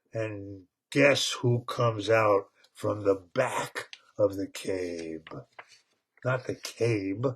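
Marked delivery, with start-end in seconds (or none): none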